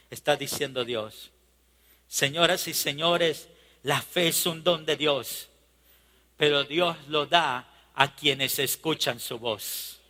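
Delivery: usual speaking voice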